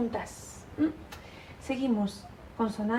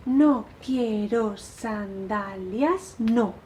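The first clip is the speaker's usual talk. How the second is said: slow, syllable-by-syllable scansion; march slowly through each syllable; recitado en marcha; much slower than usual. Shown as a demonstration. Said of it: "como si estuvierais haciendo marcha". (recitando lento, sílaba por sílaba)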